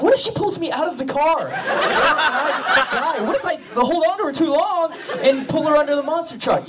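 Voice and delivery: high, laughing panic over what could go wrong; panicked, laughing. Retold or imitated. Panicking at a red light over handing quarters. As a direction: squeal out the worst-case fears through laughter. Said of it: "And so I'm freaking out".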